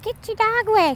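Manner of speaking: high, shrill-pitched mock yell; mocking the yelling tweaker. Mocking the tweaker who yelled about his dog, high mock yell. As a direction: imitate the junkie screeching at him. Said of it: "I don't know if you could hear her yelling".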